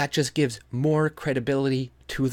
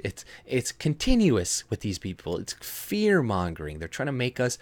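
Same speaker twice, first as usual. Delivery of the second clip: ordinary delivery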